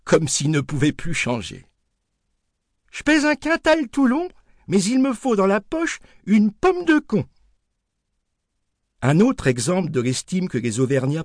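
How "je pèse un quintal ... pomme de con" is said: put-on much higher old woman reciting her saying; a grandmother proudly repeating her weight; grandmother's voice. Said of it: "ma grande garda ce chiffre dans la tête".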